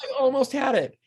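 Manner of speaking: high-pitched mock lament; imitated frustrated actress. Imitating an actress who fluffed the last word, high mock dismay.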